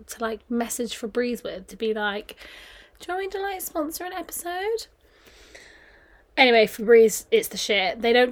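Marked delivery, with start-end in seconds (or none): 3.0-4.9 high, soft mock request; coy sponsorship pitch. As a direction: ask for a favour in a small, high voice.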